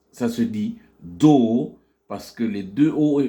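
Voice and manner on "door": "door" with a long aw and silent R; slow English model "door". Shown as a demonstration. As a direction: draw out the vowel of "door". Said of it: "le O long".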